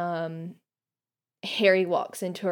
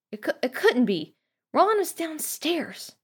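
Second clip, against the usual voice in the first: higher-pitched, stunned disbelief; slowing into high-pitched disbelief; disbelieving; 9 semitones higher than usual. Acted. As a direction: refuse to believe it, voice rising. Panicked thought in read-aloud scene.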